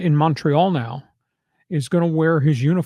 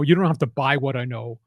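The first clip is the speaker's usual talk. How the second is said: normal talking voice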